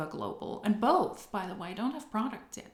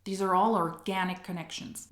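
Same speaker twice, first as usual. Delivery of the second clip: ordinary talk, nothing marked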